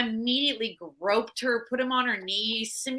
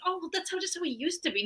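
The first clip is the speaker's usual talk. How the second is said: higher, softer mock excuse; mock excusing vegans; 7 semitones higher, 6 dB quieter, faster than usual. Podcaster mimicking vegans who excuse him, mock dismissive. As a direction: imitate people brushing it off, pitched up. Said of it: "way too many vegans saying".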